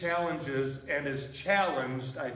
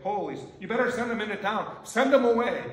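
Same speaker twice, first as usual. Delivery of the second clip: exasperated disciples; 5 semitones higher, 5 dB louder, faster than usual. Retold or imitated. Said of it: "the disciples are like".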